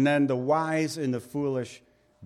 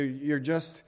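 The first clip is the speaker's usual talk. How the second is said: ordinary talk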